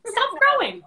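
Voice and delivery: put-on teasing scold of a little boy; mock scolding. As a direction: playfully order him to stop. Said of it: "I scold my little boy".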